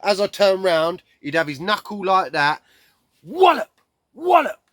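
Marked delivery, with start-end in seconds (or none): 3.2-4.6 high, loud, separate hits; explosive high-pitched sound effects; mimed blows. Retold or imitated. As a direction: punch out each word like a blow landing. Acts out repeated knuckle blows.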